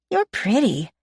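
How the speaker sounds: high, lightly voiced little girl. A narrator's reading. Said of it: "the little girl says".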